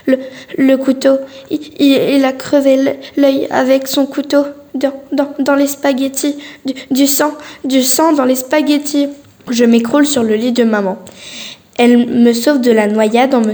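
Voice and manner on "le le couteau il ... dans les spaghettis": fake-scared stammering. Child fakes a nightmare to his mother, stammering in mock fear. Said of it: "je balbutie des mots sans suite".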